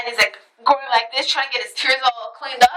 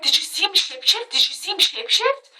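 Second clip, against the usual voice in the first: imitating an excited friend, pitched up; eager questioning; 4 semitones higher than usual. Woman imitating her acquaintance after the shapeshifting, eager. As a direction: ask it eagerly, as the other woman.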